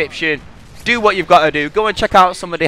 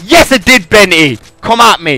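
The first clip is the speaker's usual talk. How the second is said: higher, louder challenge to Benty; loud taunt; 7 semitones higher, 7 dB louder than usual. Real, not acted. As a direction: call out Benty at full voice. Taunting a named friend.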